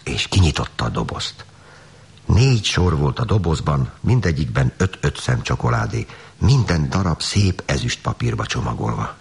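usual speaking voice

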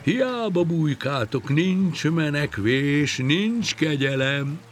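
high, drawn-out crowing holler; big whoop. Acted. Fisherman taunting the fish from the bank, high whooping holler. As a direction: whoop it out slowly across the water. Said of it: "nagyot rikkantott a víz felé".

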